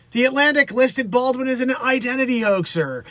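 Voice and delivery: normal talking voice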